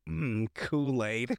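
slow, drawn-out mock relish; savouring. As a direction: stretch it out as if savouring a drink. Imitating Melvin relishing his drink.

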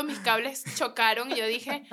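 ordinary talk

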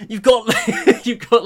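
high, loud, laughing through the words; laughing disbelief. Real, not acted. Podcaster listing a film's nutty twists, laughing disbelief. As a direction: struggle to get it out through laughter. Laughing raving about absurd plot.

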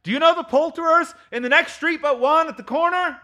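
high, carrying question; calling down. Acted. Scrooge asking the boy about the poulterer's, high calling voice. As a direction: call the question to someone below.

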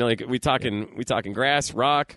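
low, slangy street-dealer patter; drug dealer. Acted. Host pitching drugs to the guest, as a lowkey dealer. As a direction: play the shady dealer sizing up a customer. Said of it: "we got drug dealer [name] over here".

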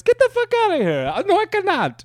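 very high squeaky movie-quote voice; a high Serge-style impression; Beverly Hills Cop impression. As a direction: squeak the line like the film. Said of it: "He goes".

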